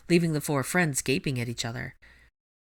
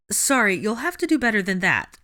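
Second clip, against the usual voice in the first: demanding; 6 semitones higher, 5 dB louder than usual. Acted. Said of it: "she demanded".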